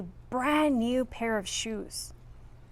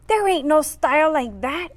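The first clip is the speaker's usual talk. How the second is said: louder, higher bully growl; 5 semitones higher, 4 dB louder than usual. Acted. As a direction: growl it down at him. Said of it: "Angel growled".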